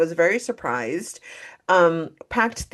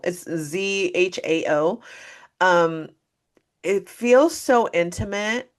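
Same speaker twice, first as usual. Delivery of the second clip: usual speaking voice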